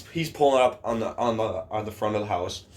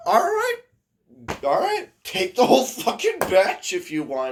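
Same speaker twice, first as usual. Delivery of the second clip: high, loud, exaggerated giving-in; mock exasperation; 11 semitones higher, 5 dB louder than usual. Pranksters offer him the whole batch, mock exasperation. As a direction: throw it out loud and high, hammed-up.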